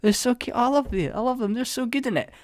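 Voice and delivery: high, gushing fan voice; high mock adoration, softening toward the end. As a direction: gush like a besotted viewer. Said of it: "you're totally like".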